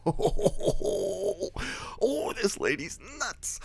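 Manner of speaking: very high falsetto ooh, stretched out; mock alarmed reaction. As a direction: play a stunned viewer in a squeaky voice.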